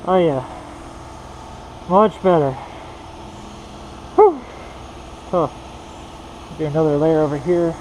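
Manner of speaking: muffled behind a full face mask; talking through a mask. Real, not acted. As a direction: voice dulled by a painting mask. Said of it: "I'm talking through a mask".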